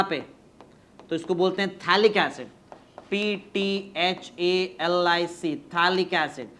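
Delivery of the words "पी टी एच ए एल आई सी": slow letter by letter spelling. Shown as a demonstration. Teacher spells the name slowly for students to copy. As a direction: dictate each letter slowly for copying. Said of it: "पी silent है".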